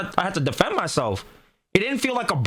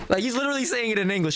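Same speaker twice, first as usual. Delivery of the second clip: ordinary talk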